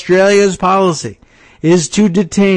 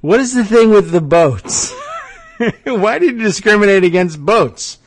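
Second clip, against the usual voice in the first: giggling while quoting Trump, a bit higher; laughing through a quote; 6 semitones higher, 3 dB louder than usual. Retold or imitated. Host laughing as he reads Trump's boat question. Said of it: "Trump says".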